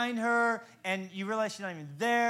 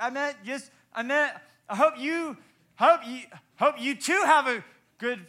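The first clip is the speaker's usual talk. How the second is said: higher, louder, tripping over words; flustered babble; 5 semitones higher, 7 dB louder than usual. Retold or imitated. Retelling his flustered fix to the flight attendant, hammed-up panic. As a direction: scramble to fix the blunder. Flustered correction on jet bridge.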